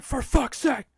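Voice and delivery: very loud, high-pitched exasperated yell; full-force acted outburst of frustration. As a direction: yell it at a stubborn object. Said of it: "Fucking yelling at an inanimate object".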